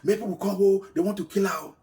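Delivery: high, loud cry for help; shouting for help. Retold or imitated. Wife shouting to the compound for help. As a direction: yell to bring the neighbours running. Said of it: "she started shouting".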